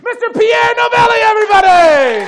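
loud, high boxing-ring introduction; announcer intro. Real, not acted. Host bringing out the first guest, loud, high announcer style. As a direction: build the crowd into the name.

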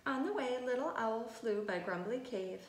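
ordinary delivery

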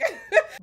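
high, loud burst of laughter; amused giggle. Real, not acted. Amused by reading out bling, giggling. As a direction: break into a squealing giggle.

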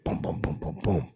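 low, soft booming beat; deep rhythmic booms; imitated music beat. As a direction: mouth a bass beat to dance to. Imitates the beat while showing dance.

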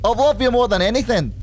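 put-on amateur Scottish accent, begging. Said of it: "How do I do a Scottish accent?".

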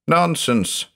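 a short snap of "Nonsense", pitched high; snapped. A narrator's reading. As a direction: cut him off with one curt word. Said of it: "I snapped".

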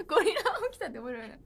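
amused by a comment; laughing. Real, not acted. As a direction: chuckle while saying it.

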